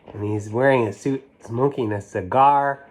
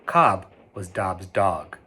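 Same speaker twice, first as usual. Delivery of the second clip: usual speaking voice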